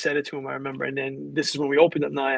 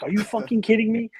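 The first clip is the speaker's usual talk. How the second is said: higher-pitched disbelieving retort; mock incredulity; 6 semitones higher, 4 dB louder than usual. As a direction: retell a friend balking at bad news. Friend reacts to unwelcome news.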